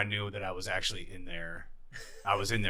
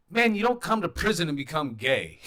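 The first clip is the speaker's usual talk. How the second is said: higher, louder retold cellmate; inmate's reassurance; 9 semitones higher, 10 dB louder than usual. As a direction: play the older inmate laughing off the fear. Jimmy reassures about prison myths.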